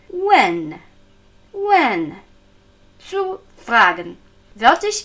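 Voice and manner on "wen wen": "Wen" falling to a clear front n; front-tongue N. Shown as a demonstration. Teacher showing front-lingual N, falling "Wen" ending on n. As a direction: drop "Wen" firmly onto the n. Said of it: "Dies ist das frontlinguale N".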